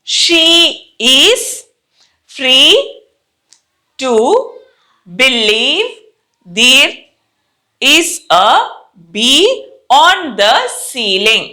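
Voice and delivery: word by word with long pauses; slow model reading. Shown as a demonstration. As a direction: read it slowly for students to copy. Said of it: "first నేను చదువుతాను, వినండి జాగ్రత్తగా".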